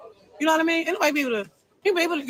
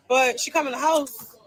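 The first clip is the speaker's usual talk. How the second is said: normal talking voice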